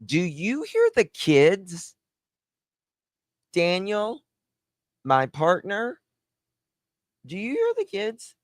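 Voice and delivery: high mocking mom voice, unhurried. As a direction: drag it out like someone half asleep. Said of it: "and you say".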